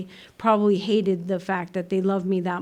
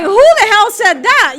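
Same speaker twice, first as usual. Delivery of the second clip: shocked outburst; 10 semitones higher, 10 dB louder than usual. Retold or imitated. Shocked at a cop's remark.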